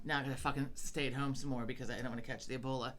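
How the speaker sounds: ordinary talk, nothing marked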